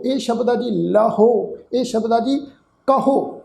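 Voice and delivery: ordinary talk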